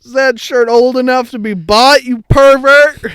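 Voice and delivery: high, very loud scandalized shout; mock outrage. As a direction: bellow a hammed-up accusation. Joke outrage at an underage shirt.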